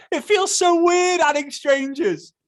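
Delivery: very high, loud, slow mocking wail; exaggerated mock whine. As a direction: ham up the worrier's complaint in falsetto. Exaggerates the worrier's complaint.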